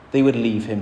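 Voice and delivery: ordinary talk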